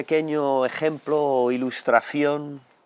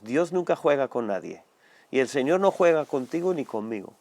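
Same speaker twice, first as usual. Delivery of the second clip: ordinary talk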